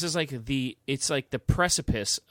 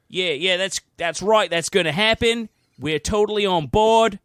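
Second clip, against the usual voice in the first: hammed-up Australian accent, higher and louder; 8 semitones higher, 10 dB louder than usual. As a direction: play Australia as a cheery Aussie agreeing far too eagerly. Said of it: "So if I can do an Australian accent".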